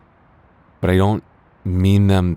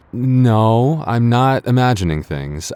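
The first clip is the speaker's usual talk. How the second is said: defensive insistence; 5 semitones higher, 4 dB louder, slower than usual. Acted.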